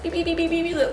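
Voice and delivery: playful mock beeping of a car lock; car lock beeps. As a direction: beep it out like a key fob, repeatedly. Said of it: "locking my car".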